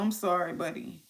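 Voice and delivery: calm the little one down; gentle soothing. Real, not acted. Mom soothing her fussy baby after yelling, soft and gentle.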